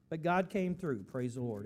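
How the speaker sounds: ordinary talk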